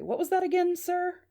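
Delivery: puzzled questioning. Acted. Confused man questioning mystery caller.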